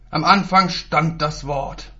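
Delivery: slow and loud, a stern preacher; strenger Lehrer. Acted. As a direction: lay down the word slowly, loudly. Strenger Lehrer zitiert Bibel. (langsam, laut und streng)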